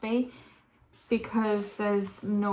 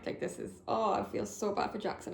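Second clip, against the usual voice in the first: ordinary talk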